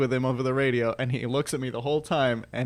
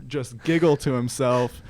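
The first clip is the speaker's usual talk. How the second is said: usual speaking voice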